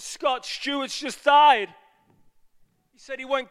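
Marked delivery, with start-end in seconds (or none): 0.0-1.7 loud, slow, stunned announcement of a death; shocked cry. Retold or imitated. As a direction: blurt it out in shock. Friend's phone call with death news.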